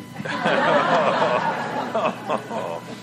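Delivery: a long soft chuckle; laughing at own joke. Real, not acted. Joke teller chuckling softly at his own punchline. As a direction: laugh it off quietly.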